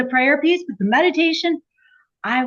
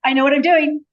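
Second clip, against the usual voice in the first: higher, louder re-enacted breakthrough; acted sudden realization; 6 semitones higher, 6 dB louder than usual. Retelling the day meditation clicked, excited realization. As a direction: exclaim it like a retold eureka moment.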